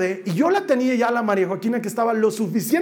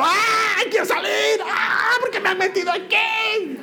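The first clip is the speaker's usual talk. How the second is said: yelling to get out, higher; girl screaming into her father's belly, high and scared; child's scared yell; 11 semitones higher, slower than usual. Retold or imitated. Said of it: "gritaba en mi panza".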